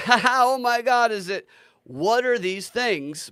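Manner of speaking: high, loud fake wonder, laughing it off; mocking a pretend-surprised insider; mock amazed gasp. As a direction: ham up a fake giddy amazement.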